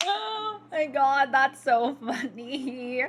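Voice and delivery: slow, high words through laughter; laughing. Real, not acted. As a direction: still giggling, words drawn out. Still laughing at comment.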